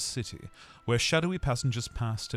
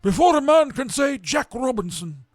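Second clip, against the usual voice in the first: put-on a fat merry master calling his boys; jovial Fezziwig; 14 semitones higher, 10 dB louder than usual. A narrator's reading. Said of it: "cried old Fezziwig".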